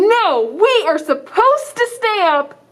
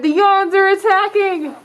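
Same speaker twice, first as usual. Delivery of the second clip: usual speaking voice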